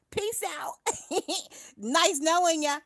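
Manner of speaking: high teasing farewell; mocking sing-song goodbye. Real, not acted. Streamer waves off a troll with a high mock goodbye. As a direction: wave them off, mocking.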